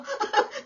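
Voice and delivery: short bright laugh at the joke; laughing aloud. Real, not acted. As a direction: burst out laughing. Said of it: "Tawa ng tawa si Ninang". (malakas na tawa)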